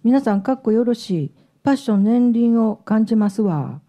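imitating a Kansai woman's speech; Kansai dialect. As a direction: read her comment with an emphatic Kansai lilt. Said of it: "関西弁なんで、 彼女".

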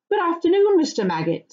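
lightly voiced polite greeting, starting high; polite young hobbit. Pippin greeting the farmer, polite and lightly acted. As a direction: greet him brightly and politely.

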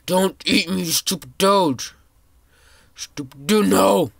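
high, loud frantic yelling at the screen; panicked yell. Real, not acted. Kid gaming, yelling at a bigger player chasing him, panicked. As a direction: yell as if you're about to be eaten.

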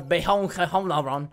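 slow, hammed-up French accent; attempted French accent. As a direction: draw the name out in a rough French accent. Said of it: "trying to say it with a French accent".

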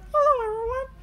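put-on high little girl apology; Peppa Pig voice. A narrator's reading. Peppa apologises to little brother George, high voice. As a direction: say sorry sweetly. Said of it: "says Peppa".

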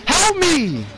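high shrill screaming for help; terrified horror victim. Acted. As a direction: beg for your life, shrieking. Begging for help while chased.

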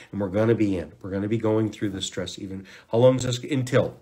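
normal talking voice

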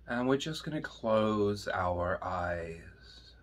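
slow, soft hushed meditation guide; guided meditation. Acted. Teacher guiding students into meditation, slow and soft. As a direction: ease them gently into closing their eyes.

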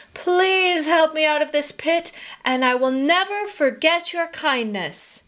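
high, imploring plea; begging. A narrator's reading. Trapped tiger to the merchant above the pit, begging. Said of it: "put his huge paws together and begged".

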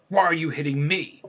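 storyteller acting an aggrieved giant; indignant giant. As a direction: demand an explanation, offended. Giant woken by rock accuses other.

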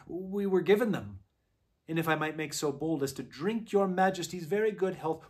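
put-on flustered old fox pleading; nervous old fox. A narrator's reading.

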